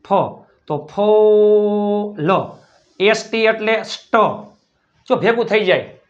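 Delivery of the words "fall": ફોલ with a very long 'o', then L; sounded out. Shown as a demonstration. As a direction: hold the vowel in ફોલ, blending slowly. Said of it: "F એટલે". (ફોલ ખૂબ લંબાવીને)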